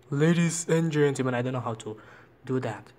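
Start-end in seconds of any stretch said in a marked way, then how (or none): 0.1-1.4 lightly deepened big man announcer; big person voice. Shown as a demonstration. Showing the voice changer's big person voice on ladies and gentlemen. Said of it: "like a big person, like".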